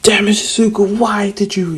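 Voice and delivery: high, loud mock scolding; hammed-up indignant yell; exasperated outburst. Bakugo scolding Izuku for retreating, exasperated.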